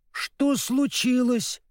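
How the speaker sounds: high, slow grieving question; sorrowful. A narrator's reading. Scarecrow finding the dog lying senseless, sorrowful. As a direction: draw it out in high-pitched distress. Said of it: "горестно спросил Страшила".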